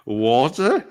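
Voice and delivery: broad Aussie with a swooping rise; one put-on Australian word, lifted into a question; Bondi Australian accent. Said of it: "Bondi B".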